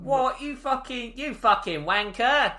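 hammed-up Cockney thug yelling an insult; Cockney villain. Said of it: "I can't get over that British guy's voice".

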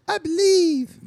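very high, breathless I believe; mocking high Iris. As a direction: squeak it like a starry-eyed believer. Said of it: "Take me".

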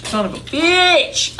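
high, slow exasperated curse; frustrated curse. Real, not acted. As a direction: curse yourself for forgetting. Swearing at forgotten memory.

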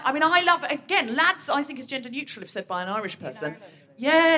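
ordinary delivery

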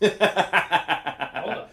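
loud, laughing hold on. Real, not acted. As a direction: blurt it out mid-laugh. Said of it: "I was just like, "Hold on".

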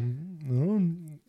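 high, tentative little check-in voice; mock-cautious high voice; imagined check-in. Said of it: "I'll be like".

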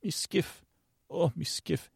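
put-on a half-hearted Australian accent, said softly and high; quiet mock Australian accent. Quoting the skiff guy in a soft, high mock Australian accent. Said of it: "I can't even do the accent right now".

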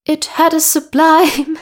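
high, loud mock-posh queen voice. Podcaster reads the Queen's words in a posh voice. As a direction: put on a grand, plummy Queen Victoria voice. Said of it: "I will read this in a posh voice".

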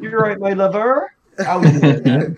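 slow, rolling West Country burr; a hobbit from the West Country greeting warmly; West Country accent. Acted. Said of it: "yeah, the accent".

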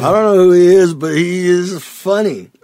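loud, high laughing quote; laughing through pain. Retold or imitated. Repeats soldier's line laughing.

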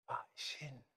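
a voiceless half-question under the breath; whispered doubt. Retold or imitated. Driver in his head, unsure of the address, whispering. Inner thought while driving.

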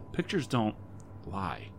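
plain reading voice

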